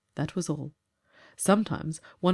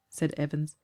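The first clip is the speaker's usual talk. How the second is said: usual speaking voice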